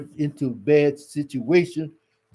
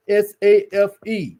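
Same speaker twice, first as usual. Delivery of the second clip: S A F E, each letter said separately and higher; spelled out letter by letter; 4 semitones higher, 4 dB louder than usual. Shown as a demonstration. Preacher spells S A F E to the congregation, letter by letter. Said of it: "sure that you are safe".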